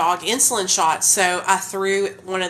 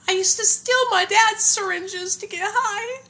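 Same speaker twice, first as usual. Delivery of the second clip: tearful; 11 semitones higher than usual. Real, not acted. Mother confessing to viewers how she got syringes, tearful. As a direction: let the tears push the voice up.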